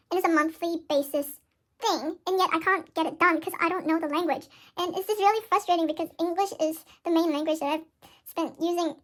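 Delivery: normal talking voice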